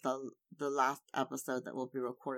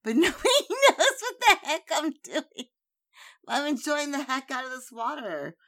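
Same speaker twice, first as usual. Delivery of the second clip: giggling through the words; laughing while talking; 12 semitones higher, 10 dB louder than usual. Real, not acted. Laughing at her own water sipping.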